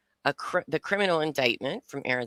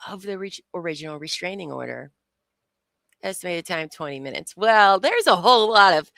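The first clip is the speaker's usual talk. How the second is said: usual speaking voice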